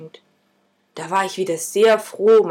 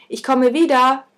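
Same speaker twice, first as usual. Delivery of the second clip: barked call; 7 semitones higher, 5 dB louder than usual. A narrator's reading. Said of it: "bellte ich ihm zu".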